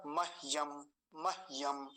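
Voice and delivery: मह्यम as mah-yam, very little h; half h. Shown as a demonstration. Teacher showing how a half हकार sounds, half h. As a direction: barely sound the h in मह्यम, no vowel. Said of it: "आधा है तो आवाज बहुत कम आएगी".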